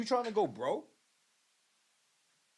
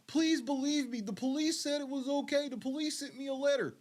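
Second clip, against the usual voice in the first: mock begging whine; 7 semitones higher than usual. Mocking him begging the audience.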